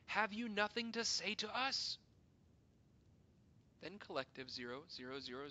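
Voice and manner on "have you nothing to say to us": high, loud pleading question; desperate cry. Acted. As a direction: beg for an answer. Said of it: "we cried".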